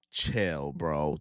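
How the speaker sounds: low, flat-toned brush-off; deadpan chill. Real, not acted. Reacting to chat comment.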